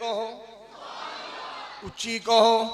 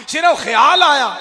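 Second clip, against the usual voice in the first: shouted answer; 5 semitones higher, 5 dB louder than usual. Shown as a demonstration. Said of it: "उच्ची बोलो की आया?".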